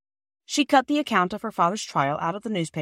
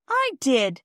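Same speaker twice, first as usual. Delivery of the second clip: screamed; 7 semitones higher, 3 dB louder than usual. A narrator's reading. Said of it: "screamed Phyllis".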